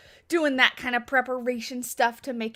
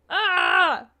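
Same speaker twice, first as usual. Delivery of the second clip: short loud cry of frustration; a burst of real annoyance at the game bug; frustrated yell; 6 semitones higher, 7 dB louder than usual. Real, not acted. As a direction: let out a sharp frustrated ah. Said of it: "I'm so frustrated".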